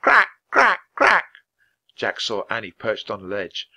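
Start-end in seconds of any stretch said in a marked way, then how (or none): 0.0-1.2 high, loud raven caws; alarmed cawing. Acted. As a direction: sentries cawing madly in alarm. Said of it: "cawing madly in alarm".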